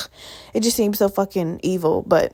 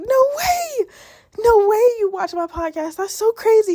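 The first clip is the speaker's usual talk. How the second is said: much higher, thrilled squeal of disbelief; excited disbelief; 12 semitones higher, 3 dB louder than usual. Retold or imitated. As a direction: gush like you can't believe a fan found you. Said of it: "I was so excited".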